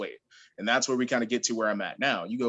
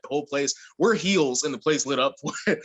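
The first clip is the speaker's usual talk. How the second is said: normal talking voice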